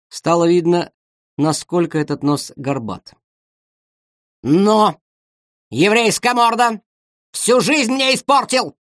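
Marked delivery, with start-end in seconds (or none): 4.4-8.8 high, slow hateful yell; shouting. Acted. Big woman shouting at the violist. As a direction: scream abuse at him. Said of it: "крикнула большая женщина".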